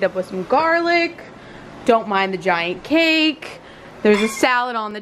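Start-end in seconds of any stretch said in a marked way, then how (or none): none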